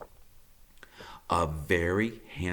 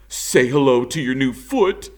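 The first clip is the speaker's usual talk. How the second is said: proud beaver; 5 semitones higher, 8 dB louder than usual. Acted. Beaver presenting new foot.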